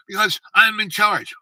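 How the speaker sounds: retold fatherly shout, high and loud; loud, insistent mock command; screaming orders. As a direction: imitate a colonel dad pulling rank. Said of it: "he started just screaming orders".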